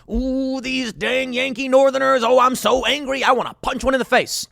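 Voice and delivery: mock him as a cartoon rebel; mock angry Southerner. Angry Southerner voiced in parody.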